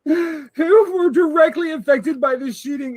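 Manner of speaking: ordinary delivery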